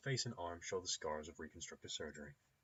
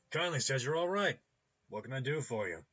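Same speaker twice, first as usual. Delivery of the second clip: hearty, loud, higher-pitched mechanic-doctor voice; jovial clinic owner; 8 semitones higher, 10 dB louder than usual. Acted. Clinic owner asking a new customer's needs, jovial and loud. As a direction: greet the customer loudly and heartily. Ambrose greets a vouched-for customer.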